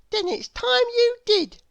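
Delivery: put-on bright childlike schoolboy voice; young William. A narrator's reading. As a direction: pitch it up like an eager small boy. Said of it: "replied William".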